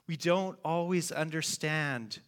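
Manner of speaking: ordinary talk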